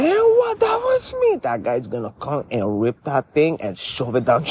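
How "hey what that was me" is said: high, loud taunting boast; squeaky defiant brag much higher; mock boast. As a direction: shout it like a cocky troublemaker. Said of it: "you're gonna say".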